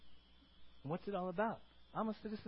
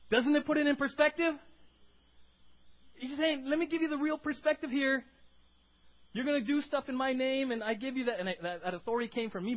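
high, loud, driving sermon voice; emphatic preaching; 9 semitones higher, 8 dB louder than usual. Real, not acted. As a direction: preach it forcefully at a raised pitch. Preaches at full force.

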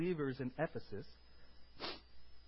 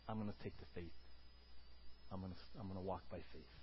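quiet resolve; 6 semitones lower, 10 dB quieter, faster than usual. Acted. Inner faith resolve, quietly voiced.

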